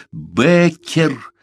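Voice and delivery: high, drawn cry of the name; shouting. A narrator's reading. Парсел tries to stop raving Беккер, shouting. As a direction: call out urgently, pitch jumping up. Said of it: "закричал Парсел".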